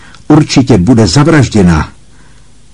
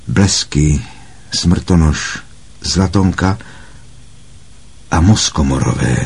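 plain reading voice

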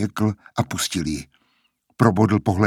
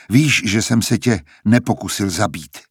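ordinary delivery, nothing marked

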